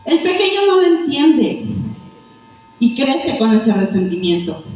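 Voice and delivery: ordinary delivery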